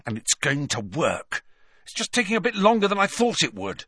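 put-on a boy bragging to the teacher; defiant Malfoy. A narrator's reading. Malfoy refusing Snape's protection, high and defiant.

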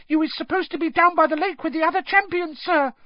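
fast, very high elf squeak; frantic high-pitched elf; Dobby. A narrator's reading. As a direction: gabble urgently in a squeaky voice. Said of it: "squeaked Dobby".

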